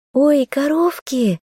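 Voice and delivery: put-on very high, slow childlike coo; delighted young girl. A narrator's reading. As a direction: coo with delight at cute animals. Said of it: "заулыбалась Маша". (восторженно, тонким детским голоском)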